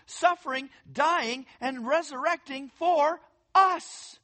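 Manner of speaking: fervent pulpit preaching; preaching at full force. Real, not acted. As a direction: preach it out to the room, building.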